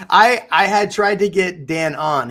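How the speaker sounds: words through a laugh, slowed. Real, not acted. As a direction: laugh while you confess it. Laughing while apologizing.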